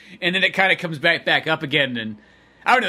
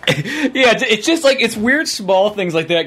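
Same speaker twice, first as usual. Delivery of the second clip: loud laughing; 5 semitones higher, 8 dB louder than usual. Real, not acted. Burst after laughter.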